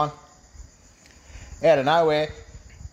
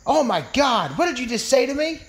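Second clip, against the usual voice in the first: very high-pitched appalled woman's voice; shocked falsetto rebuke; offended woman; 10 semitones higher than usual. Acted. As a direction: shoot the pitch up in mock outrage. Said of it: "She says".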